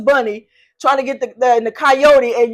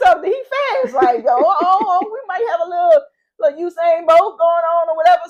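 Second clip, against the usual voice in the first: high, fast, thrilled gushing; bubbling over at her fast kid; excited mom; 7 semitones higher, 4 dB louder than usual. Retold or imitated. Mom realizing her son is fast, high excited gush. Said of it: "So I was like".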